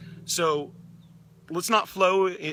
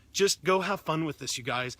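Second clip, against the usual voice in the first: usual speaking voice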